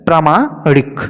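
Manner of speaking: ordinary delivery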